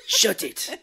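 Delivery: put-on loud, high shouted Japanese accent; mugger's Japanese accent. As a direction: bark it in a mock Japanese accent. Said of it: "same accent as the guy".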